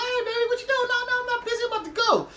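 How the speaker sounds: very high, gushing mock phone voice; hammed-up falsetto sweet talk. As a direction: coo eagerly into the phone. Voices Bibby taking another phone call.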